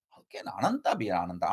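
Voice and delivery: a quick whispered question, like a student thinking aloud; hushed rhetorical question; whispered doubt. Acted. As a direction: murmur the objection under your breath. Teacher voices a doubting student.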